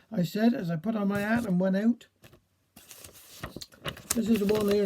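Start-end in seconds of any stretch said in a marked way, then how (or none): none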